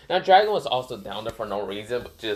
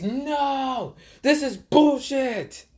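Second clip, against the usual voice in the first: high-pitched, drawn-out protest; outraged yell; much slower than usual. Retold or imitated. Raikage protesting the lost match, outraged yell. Raikage furious at losing the bet.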